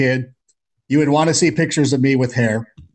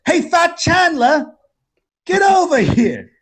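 calling across casino; 14 semitones higher, slower than usual. Retold or imitated. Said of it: "he's like".

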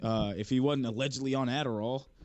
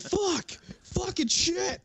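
very high, drawn-out mock cursing outburst; hammed-up high-pitched curse yell; too-loud cursing; 4 dB louder, much slower than usual. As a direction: imitate someone swearing way too loud. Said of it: "wrong curse word way too loud".

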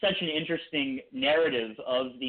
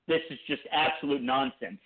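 normal talking voice

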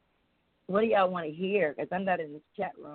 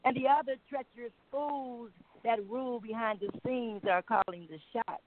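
poem recitation; 3 semitones higher than usual. Acted. Caller recites her political poem.

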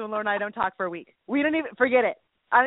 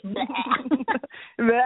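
mock gag; 2 semitones higher than usual. Mock disgust.

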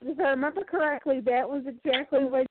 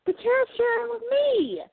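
mock whiny protest; 11 semitones higher than usual. Real, not acted. Mock complaint about ice cream.

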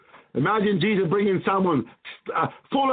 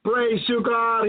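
ordinary talk, nothing marked

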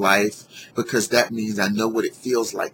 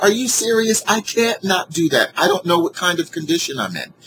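exasperated disbelief; 9 semitones higher, 3 dB louder than usual. Retold or imitated. Arguing about calling 911.

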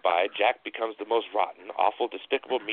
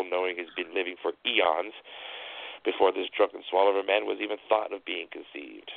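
usual speaking voice

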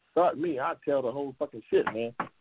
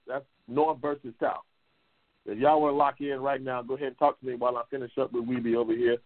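ordinary talk, nothing marked

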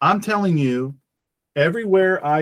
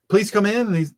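normal talking voice

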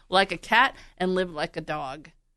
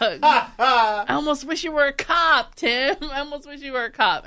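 laughing; 6 semitones higher than usual. Real, not acted. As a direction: tease Tim through giggles. Teasing husband through laughter.

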